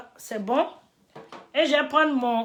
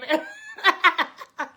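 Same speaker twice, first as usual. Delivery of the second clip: light laugh; 6 semitones higher than usual. Real, not acted. Laughing at chat replies.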